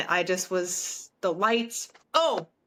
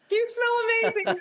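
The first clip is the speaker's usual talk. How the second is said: much higher, squeaky starstruck fan; starstruck squeak; 13 semitones higher than usual. Retold or imitated. Fan to the singer, starstruck squeak. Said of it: "That's what I said".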